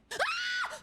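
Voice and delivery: very high short scream; shriek of fright. Real, not acted. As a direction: squeal at the sudden scare. Startled again.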